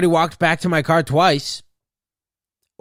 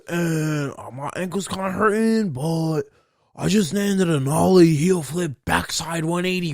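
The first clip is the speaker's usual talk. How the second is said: high, slow surfer-dude drawl; skater-dude doctor; 5 semitones higher, slower than usual. Retold or imitated. Skater doctor greeting patient Michael, in a high laid-back dude voice. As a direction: play a laid-back skater bro with a lab coat. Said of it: "here comes the Vans doctor. The skater boy".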